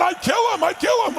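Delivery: high-pitched mock rage; imitating furious friend. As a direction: repeat the threat like a hothead. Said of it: "my furious friend over here".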